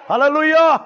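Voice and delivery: high, shouted praise call; loud hallelujah. Real, not acted. Preacher leads everyone in praise, loud hallelujah. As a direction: shout it to rally the whole congregation. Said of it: "గట్టిగా హల్లెలూయా చెప్దాం".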